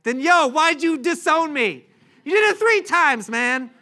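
high, loud, hammed-up indignant yelling; mock outrage. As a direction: confront him in exaggerated disbelief, shouting. Imagined outraged reproach from Jesus.

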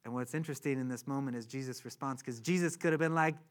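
usual speaking voice

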